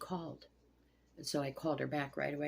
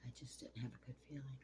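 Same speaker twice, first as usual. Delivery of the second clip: hushed grief; 11 dB quieter, faster than usual. Real, not acted. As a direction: barely get it out. Recalling the call about friend's death.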